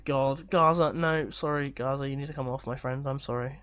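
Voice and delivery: ordinary talk, nothing marked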